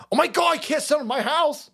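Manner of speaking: high-pitched mock panic; put-on voice of scandalized refusal; horrified hypocrite. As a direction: squeal it like an appalled objector.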